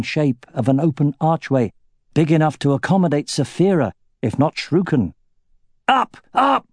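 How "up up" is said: high urgent battle shout. A narrator's reading. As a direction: yell it to the men. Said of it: "Roran shouted".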